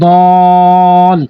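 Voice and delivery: দল with the vowel held long before the stop; four-alif stretch on দল; long madd stretch. Shown as a demonstration. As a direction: stretch দল slowly before the hold. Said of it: "অবশ্যই ধরার আগে টানবেন".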